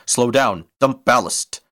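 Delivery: loud, high-pitched panicked order; panicked cry. A narrator's reading. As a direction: cry out urgent commands in alarm. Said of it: "Bimard cried in panic".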